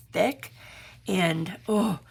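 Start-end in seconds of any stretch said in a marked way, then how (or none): none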